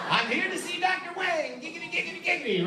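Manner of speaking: sleazy high-pitched giggity chant; Quagmire impression. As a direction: ham it up like a leering cartoon neighbour. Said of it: "like fucking Quagmire".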